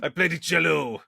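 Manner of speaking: put-on proud French accent, laughing; a French snob bragging about the cello. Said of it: "he's gotta be French".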